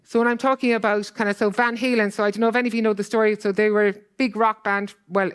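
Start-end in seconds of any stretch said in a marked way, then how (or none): none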